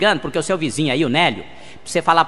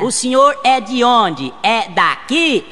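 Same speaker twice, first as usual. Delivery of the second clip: slow, loud and higher, each word drawn out; speaking very slowly; 6 semitones higher, 3 dB louder, much slower than usual. Acted. Brazilian tourist speaks slowly to a German taxi driver. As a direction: spell it out for a foreigner. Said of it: "você fala devagar".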